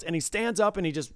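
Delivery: ordinary talk, nothing marked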